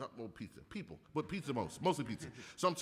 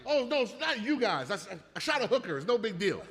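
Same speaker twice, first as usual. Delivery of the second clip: loud casual reassurance; 6 semitones higher, 6 dB louder than usual. Retold or imitated. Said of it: "I'm like".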